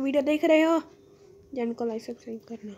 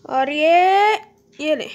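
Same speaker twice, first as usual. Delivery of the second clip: much louder battle cry; fierce shout; 3 semitones higher, 11 dB louder, slower than usual. Real, not acted. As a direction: bellow the strike. Striking the creeper.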